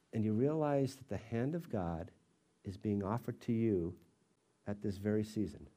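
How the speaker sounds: ordinary talk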